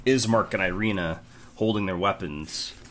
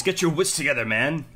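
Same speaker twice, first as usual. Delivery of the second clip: stern rebuke; 7 semitones higher, 7 dB louder than usual. Acted. Irina snaps at dazed man.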